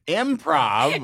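high, sneering single word; mock disdainful parent. Mocking immigrant parents, high and scoffing. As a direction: scoff at the very idea.

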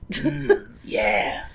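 leering mm. Retold or imitated. Leering over Bernadotte.

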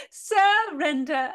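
slow, wailing, theatrical word; mock wail. Repeated with cry.

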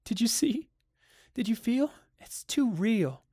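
high, frightened questions, slowing at the end; tearful fear. A narrator's reading. Crying wife appeals to her husband, high and fearful. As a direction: plead with him to share the fear. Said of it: "and cried steadily".

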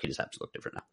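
soft breathy whisper; whispered aside. Retold or imitated. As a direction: murmur it under your breath. Repeats the excuse, whispered.

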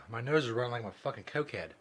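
usual speaking voice

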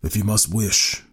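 slow, mildly gruff warning; a touch of gruffness, drawn out. A narrator's reading. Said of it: "he said gruffly".

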